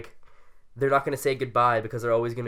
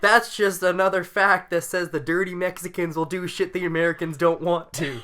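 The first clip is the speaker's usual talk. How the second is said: roast quote; 5 semitones higher, 4 dB louder than usual. Retold or imitated. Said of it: "And he's like".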